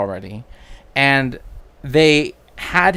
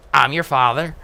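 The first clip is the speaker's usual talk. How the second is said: put-on 1 West Country pirate accent; 2 semitones higher than usual. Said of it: "That's literally how it would have sounded".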